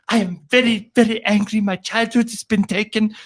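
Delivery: high, put-on whiny girl voice; stamp-your-foot sulky child; bossy little princess. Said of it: "stomped her foot and went".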